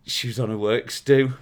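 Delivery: ordinary talk, nothing marked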